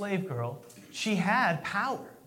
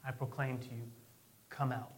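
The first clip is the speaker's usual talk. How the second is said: quiet solemn command; 6 semitones lower, 12 dB quieter than usual. Retold or imitated. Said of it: "he says".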